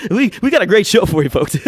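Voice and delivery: high, fast, loud late-night host; Jimmy Fallon impression. Shown as a demonstration. Podcaster demos an easy Fallon for listeners, high and giddy. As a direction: gush the show intro like a giddy host. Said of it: "Although Fallon, I feel like, is pretty easy".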